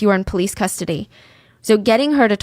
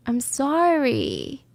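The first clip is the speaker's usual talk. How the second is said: soft, drawn-out sorry with a lilting end; rehearsed apology; 3 semitones higher, 5 dB quieter, much slower than usual. Retold or imitated. Suspect practising versions of sorry, slow and affected. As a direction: try another slow version, unfelt and rising. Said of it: "one line in different emotions".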